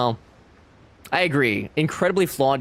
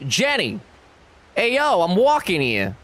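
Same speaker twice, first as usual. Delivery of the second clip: put-on New York accent; 6 semitones higher than usual. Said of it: "Forrest is like".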